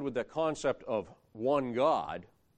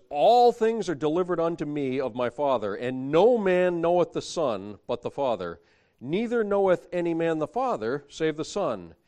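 scripture reading; 3 semitones higher, 6 dB louder than usual. Acted.

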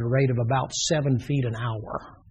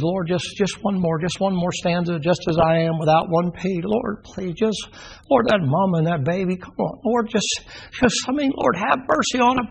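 desperate begging; 5 semitones higher than usual. Acted. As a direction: pitch climbing higher as he pleads. Noah begging for one more stanza.

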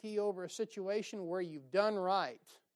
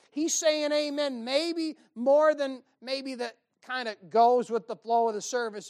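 preaching that builds louder and higher; building preaching; 5 semitones higher, 8 dB louder than usual. Acted. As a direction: climb into a full revival shout. Preaching builds to full force.

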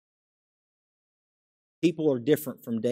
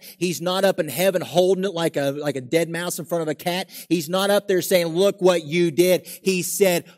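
high, loud building sermon; preaching at full force; 5 semitones higher, 5 dB louder than usual. Real, not acted. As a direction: press the point home to the congregation.

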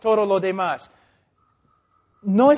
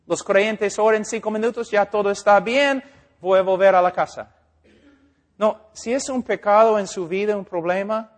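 ordinary talk

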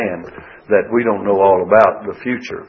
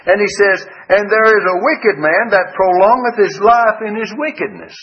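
raised, higher preaching read of the verse; scripture reading; 8 semitones higher than usual. Acted. Preacher reading Ecclesiastes to the congregation, raised and solemn. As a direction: proclaim the verse to the pews.

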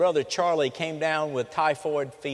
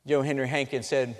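ordinary talk, nothing marked

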